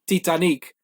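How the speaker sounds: Titanic as Tee-tah-NEEK, stress on the end; French-like ending on Titanic; movie-title pronunciation. Shown as a demonstration. Guest shows how Portugal says the film, Titanic stressed last. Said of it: "They would say the movie".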